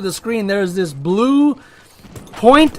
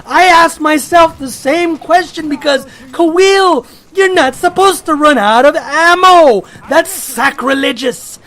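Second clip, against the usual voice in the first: mock outrage; 6 semitones higher, 4 dB louder than usual. Mock outrage at himself over ammo.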